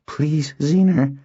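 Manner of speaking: slow, high entreaty; pleading. A narrator's reading. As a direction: coax an angry friend, drawing it out. Calming an angry friend, pleading.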